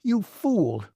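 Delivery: high, sharp angry retort; the superintendent insulting his boss; snapped insult. A narrator's reading. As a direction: spit out the insult in contempt. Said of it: "snapped Warren".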